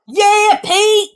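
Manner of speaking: high, mocking cheer; jeering crewman. A narrator's reading. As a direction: cheer him on with a sneer.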